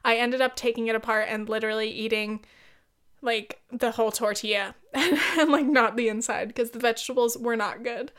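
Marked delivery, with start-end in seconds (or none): none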